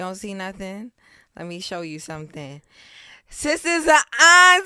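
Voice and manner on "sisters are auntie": loud, high excited announcement; excited shout. Real, not acted. Announcing news to friends, excited high shout. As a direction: shout it out with joy.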